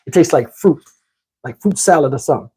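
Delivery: usual speaking voice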